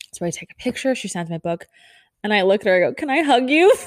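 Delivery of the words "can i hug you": higher, louder, giddy with a laugh; excited ask. Retold or imitated. Fan asks her idol for a hug, giddy and laughing. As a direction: blurt it out, giddy with nerves.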